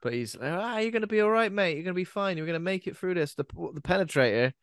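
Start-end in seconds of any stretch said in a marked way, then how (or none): 0.5-3.3 high-pitched, lightly acted buck-up to a mate; hammed-up reassurance. Injured astronaut telling his mate it's fine, mock reassuring. Voicing dying friend being reassuring.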